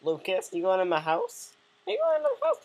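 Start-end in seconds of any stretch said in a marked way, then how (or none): 1.9-2.6 very high, louder cartoon squeak; squeaky indignant question. Catching Lucas walking into the house, squeaky and indignant. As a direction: squeal it as if offended.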